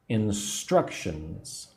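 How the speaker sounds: "Instructions" slowly, middle syllable "struc" emphasized; measured in-STRUC-tions for learners; stress on part two. Shown as a demonstration. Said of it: "put the emphasis on part two".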